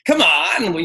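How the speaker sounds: uncle's bon vivant voice. Shown as a demonstration. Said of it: "my uncle's voice will come out".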